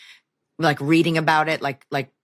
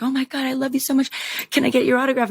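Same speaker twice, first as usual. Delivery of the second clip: higher, excited fan voice; gushing fan; 9 semitones higher, faster than usual. Retold or imitated. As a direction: mimic the girl begging for an autograph. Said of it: "this girl came over and was like".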